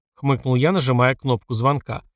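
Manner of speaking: usual speaking voice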